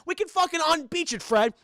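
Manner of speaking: high, worked-up cartoon slacker; Shaggy. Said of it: "He's like".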